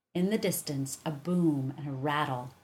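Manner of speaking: ordinary delivery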